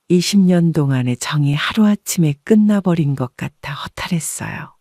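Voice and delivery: usual speaking voice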